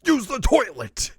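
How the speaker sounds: loud, high, drawn-out command; exasperated mock yell. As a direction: holler it slowly in cartoon outrage. Vegeta yells at Goku.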